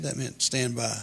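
ordinary talk, nothing marked

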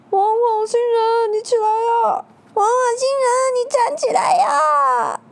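high, loud tearful shout; crying shout. Acted. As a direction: cry out for him to get up. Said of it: "大家边哭边喊".

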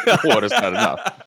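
laughing hard while repeating the joke title. Real, not acted. Echoing the co-host's joke movie title, laughing out loud.

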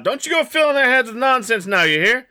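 high-pitched mother calling out; Petunia. Acted. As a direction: call a warning from the next room. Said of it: "hear Petunia call out".

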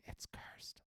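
hushed, eerie whisper; spooky whisper. Pretend. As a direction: whisper it like a ghost story. Spooky aside about cursed cave.

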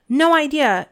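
Harry flatly denies it, raising his voice; loud. Acted. Harry defying Umbridge to her face, loud and high. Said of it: "said Harry loudly".

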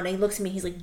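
normal talking voice

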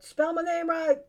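high, loud playful command; loud, high mock haunting order; ghost demand. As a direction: shout it as a ghost at the funeral. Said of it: "and I just pop out".

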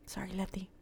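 very soft whispered apology; whispered aside; hushed aside. Real, not acted. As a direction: drop to a near-whisper for a quick sorry. Quick apologetic aside after joking.

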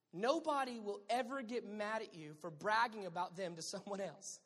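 ordinary talk, nothing marked

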